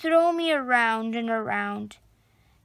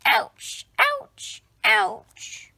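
mock pained ouch; 5 semitones lower, much slower than usual. A narrator's reading. Toy tumbles down.

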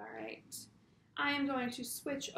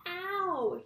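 a sharp, higher, louder yelp of pain; shouted ow; 7 semitones higher, 4 dB louder than usual. Acted. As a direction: cry out as if burned. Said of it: "he shouted".

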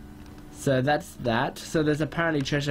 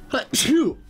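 high-pitched, sudden sneeze; 11 semitones higher, 4 dB louder than usual. Real, not acted. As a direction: let out a sharp, high achoo. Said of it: "I'm gonna sneeze".

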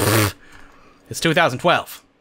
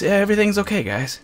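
normal talking voice

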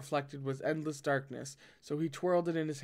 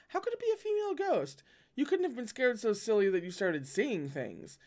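incredulous teasing; 7 semitones higher than usual. Acted. Lan Jingyi scoffs, voice jumps.

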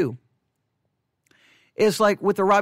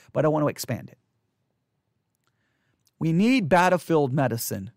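ordinary delivery

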